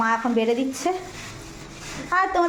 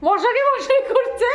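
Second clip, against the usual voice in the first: laughing; 13 semitones higher, 4 dB louder, slower than usual. Real, not acted. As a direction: laugh while repeating it. Imitating the baby, laughing. (হাসতে হাসতে বলা)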